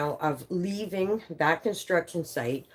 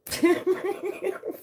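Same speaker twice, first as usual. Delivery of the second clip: high-pitched burst of laughter; cracking up at herself. Real, not acted. Streamer learns Anita's age, high laugh.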